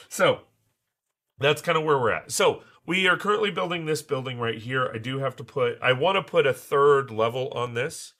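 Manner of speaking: ordinary talk, nothing marked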